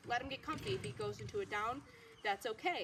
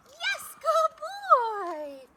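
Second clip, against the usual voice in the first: excited praise; 12 dB louder, much slower than usual. Real, not acted. Praising dog after release.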